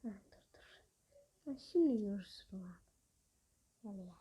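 hushed, breathless whisper; sneaking up and whispering. Real, not acted. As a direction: whisper so the enemy won't hear.

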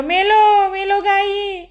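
high, panicked cry while falling in; terrified cry. A narrator's reading. Boy pushed into the well cries out in panic. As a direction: shriek as if drowning. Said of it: "मी ओरडलो".

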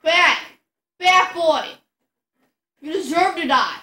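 loud furious yelling; berserk rage. Real, not acted. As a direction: scream at the enemy. Yelling at enemies in game.

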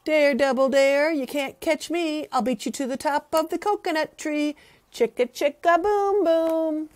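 high, loud, fast taunt; daring taunt. Acted. Letter A taunting the others with a dare. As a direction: dare them cheekily. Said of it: "this is what he said".

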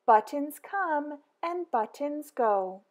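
plain reading voice

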